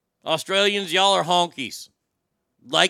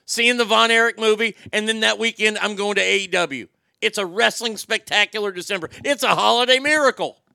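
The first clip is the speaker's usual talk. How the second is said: loud, higher-pitched wrestling hype; excited announcement; 5 semitones higher, 8 dB louder than usual. Real, not acted. Host bragging to viewers about premiere tickets, excited announcement. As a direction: crow it out loud and high, really thrilled.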